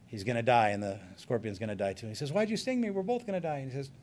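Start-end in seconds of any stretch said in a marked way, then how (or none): 2.3-3.6 high-pitched dying protest; small voice of a hurt frog; dismayed frog. Acted. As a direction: ask it high and plaintive, as the betrayed frog. Stung frog protests while dying.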